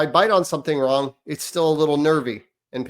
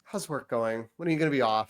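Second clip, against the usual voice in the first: drained reply; 3 semitones lower, 7 dB quieter than usual. Retold or imitated. Dad replies to wife, drained.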